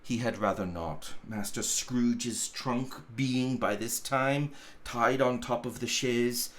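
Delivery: plain reading voice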